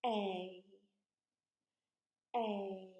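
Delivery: A drawn out, gliding as it goes; long vowel A. Shown as a demonstration. Teacher shows children the long sound, A stretched out. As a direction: hold the letter name A long. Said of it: "You say it as we write it".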